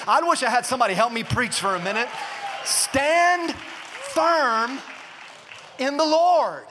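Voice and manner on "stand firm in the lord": higher, drawn-out call with pauses between phrases; rallying preacher's call. Real, not acted. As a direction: call it out to the congregation in separate beats. Preacher rallies the congregation.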